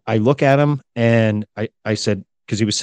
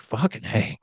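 muttered thought; 8 dB quieter than usual. Retold or imitated. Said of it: "just thinking in my head".

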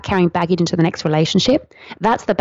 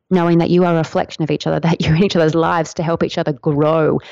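ordinary talk

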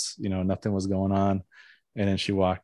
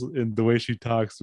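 ordinary talk

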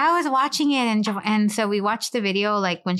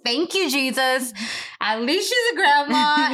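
relieved laugh; 5 semitones higher than usual. Retold or imitated. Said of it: "I was like".

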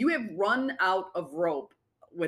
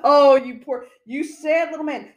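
louder, higher mock sympathy; mock pity; 7 semitones higher, 8 dB louder than usual. Real, not acted. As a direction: pity him like a poor little thing. Mocking pity for co-host.

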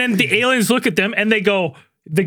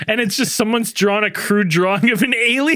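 ordinary delivery

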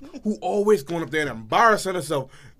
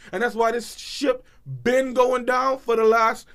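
normal talking voice

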